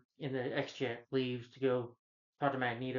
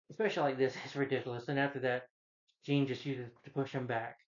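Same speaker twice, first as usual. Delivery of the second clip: usual speaking voice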